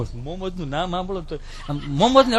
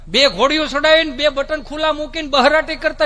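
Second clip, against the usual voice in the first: ordinary delivery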